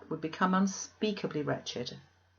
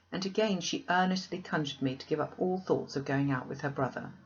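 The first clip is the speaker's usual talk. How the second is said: usual speaking voice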